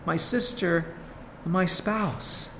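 soft, tender words of endearment. Acted. Pastor softly voicing the Lord's endearment to his people. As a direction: say it softly, as to a beloved. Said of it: "Notice that added touch of endearment".

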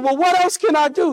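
put-on high, quick boy's appeal; pleading boy. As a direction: plead fast like a stuck kid.

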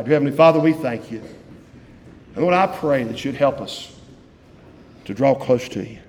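pastoral prayer, low and earnest; closing prayer. Real, not acted. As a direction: lead the church in prayer.

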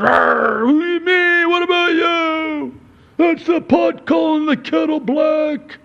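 high, loud, exasperated spouse bickering back; mock marital quarrel. Husband mocking a spouse's side of a petty argument, high and loud. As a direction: whine it like a spouse who won't back down.

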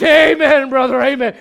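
loud, high mock congregation cheer; rousing amen shout. Preacher mimics congregation cheering that Jesus wept, exuberant shouting. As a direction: holler back like a fired-up church member.

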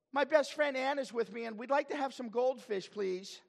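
high, loud, hammed-up little-child voice; Sylvia. Child back with best friend asks for goldfish, mock childish eagerness. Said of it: "and Sylvia says".

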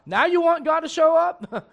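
loud, high, driving preacher voice; fiery preaching. Acted. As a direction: preach it hard at the congregation. Preaching fired up at the unbeliever.

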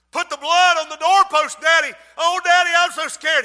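scared son begging his father to mark the door, high and pleading; frightened boy. Acted. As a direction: beg daddy in panic.

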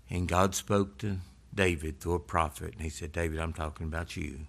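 hushed solemn judgment; quiet judgment. Acted. David and prophet quietly voiced.

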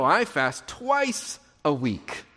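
slow boast, lingering on "twice"; mock bragging, drawn out with weight on "twice"; imitated boasting. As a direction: announce it for everyone, stretching out "twice". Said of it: "he wants everyone to know".

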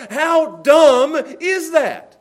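loud outcry. Acted. Rhetorical outcry in sermon.

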